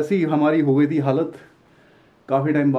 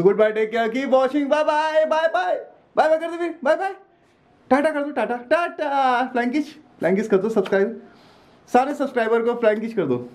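higher, sing-song coaxing; baby talk goodbye; 7 semitones higher than usual. Acted. Father getting his son to wave bye to viewers. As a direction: cheer the baby into waving bye.

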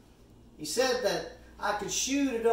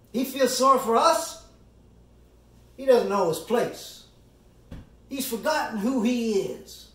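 higher, incredulous opening then falling off; mock indignation; 3 semitones higher, 5 dB louder than usual. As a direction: voice the jury's affront with scorn. Voices the offended jury's reaction.

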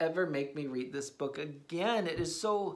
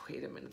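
sighing wait; 5 semitones lower, 12 dB quieter, much faster than usual. Acted. Sighs at more pages.

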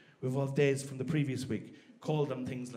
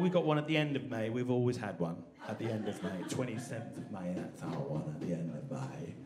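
low, soft English voice, trailing lower; English accent; 6 semitones lower, 4 dB quieter than usual. Acted. English person explaining the May holiday, low English accent. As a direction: sink into a reserved English accent. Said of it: "then the English are going".

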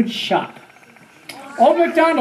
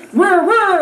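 rising whir with a higher second whir; drill sound; 7 semitones higher, 4 dB louder than usual. Shown as a demonstration. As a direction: spin it like a drill. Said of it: "this goes".

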